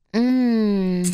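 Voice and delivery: langgerekt gespeeld 'mm'; genietend gekreun. Acted. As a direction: kreun met gesloten mond. Genietend geluid tijdens verhaal. (aangehouden genietende zucht)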